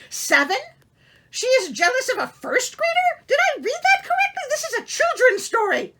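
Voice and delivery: high, loud incredulous cry breaking into a laugh; can't believe what you just read; outraged disbelief. Real, not acted. Reader reacts to the jealous queen, loud disbelief.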